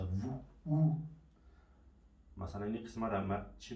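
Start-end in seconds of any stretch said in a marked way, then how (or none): 0.0-1.0 slow, drawn-out oo in "وو، او"; long vowel. Shown as a demonstration. Teacher giving examples of the French vowel, long vowel. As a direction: hold each vowel of "وو، او" long.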